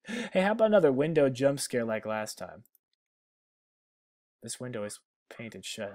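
normal talking voice

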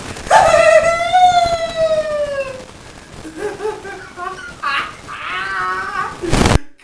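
long, very high screaming laugh; manic laugh-cry shout. Real, not acted. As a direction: howl with laughter and pain.

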